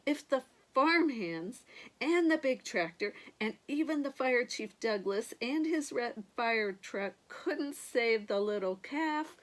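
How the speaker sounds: ordinary delivery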